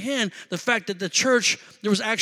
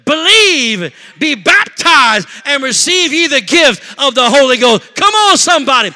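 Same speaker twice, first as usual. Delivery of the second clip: high, loud, drawn-out shouts to the congregation; full-force preaching; 8 semitones higher, 13 dB louder, slower than usual. Acted. Preacher to congregation on three thousand souls saved, full-force preaching. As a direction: belt it out slowly to rouse the church.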